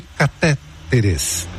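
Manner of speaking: catéteres drawn out slowly, stressed on TÉ; proparoxytone catéteres. Shown as a demonstration. Reading a listener's question, catéteres slowly stressed on TÉ. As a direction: slowly sound out catéteres, stress third syllable from the end. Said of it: "apesar de o segundo ser falado".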